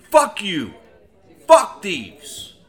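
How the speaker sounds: loud, furious curse at the thieves; angry outburst. Real, not acted. Ranting host curses the thieves who robbed his car, loud and furious. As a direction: spit it out, fed up and loud.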